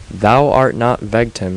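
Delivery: usual speaking voice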